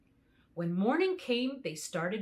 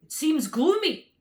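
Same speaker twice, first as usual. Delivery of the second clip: scarecrow; 7 semitones higher, 8 dB louder than usual. A narrator's reading. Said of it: "said the scarecrow".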